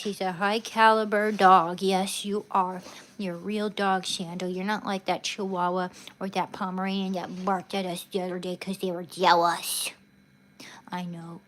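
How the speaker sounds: ordinary delivery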